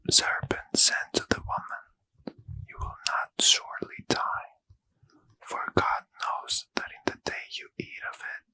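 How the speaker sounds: plain reading voice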